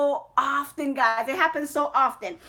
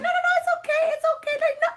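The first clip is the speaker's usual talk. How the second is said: high, sing-song fake reassurance; mock reassurance; 13 semitones higher, much faster than usual. Teasing the caught gossipers, high and sugary. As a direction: sweetly let them off the hook, voice up high. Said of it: "And I'll be like".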